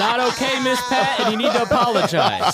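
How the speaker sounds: high, laughing mock reprimand; mock scolding. Host jokingly scolding Miss Pat, high and laughing. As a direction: scold her in jest while laughing.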